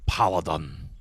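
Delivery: Paladin, light Scottish lilt, falling at the end; Scottish paladin impression. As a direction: lean on Paladin in a mock Scottish brogue. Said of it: "I love how he says".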